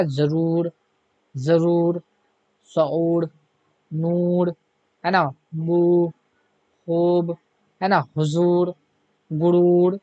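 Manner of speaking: long drawn ऊ in जरूर, नूर, बू, गुरूर; long vowel. Shown as a demonstration. Teacher repeats the examples, drawing out the long ऊ. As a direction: hold the ऊ sound long in each word. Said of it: "इस वाव को हम लोग खींच के पढ़ते हैं".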